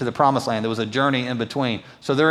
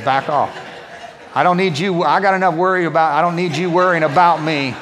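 mock snapping; 3 semitones higher than usual. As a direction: shoo them off in jest. Teasing the worried congregation.

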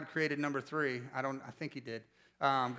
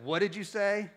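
higher mock-indignant challenge; confrontational challenge; 5 semitones higher, 4 dB louder than usual. Preacher imitating his player confronting a rival, indignant. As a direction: retell it as a player squaring up.

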